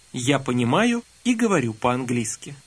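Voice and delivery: normal talking voice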